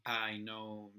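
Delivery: ordinary talk, nothing marked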